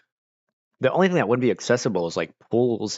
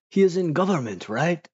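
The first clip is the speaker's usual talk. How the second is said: imitated questioner; 9 semitones higher than usual. Imitates foreigners' eager question.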